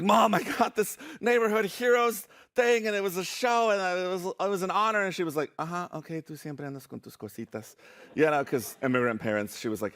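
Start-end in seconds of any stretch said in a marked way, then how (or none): 0.0-5.0 high, eager rush of good news; excited announcement. Retold or imitated. Kid telling mom about the show, excited. 5.6-7.8 dismissive mom. Retold or imitated. Immigrant mom answering her child's news, softly dismissive.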